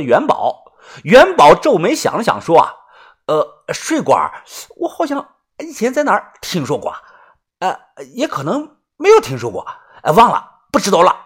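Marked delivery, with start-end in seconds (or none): 3.3-11.1 hesitant voice with rising pitch; puzzled simple follower. Acted. Yuanbao trying to recall a name, hesitant and puzzled. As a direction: grope for the memory, then give up.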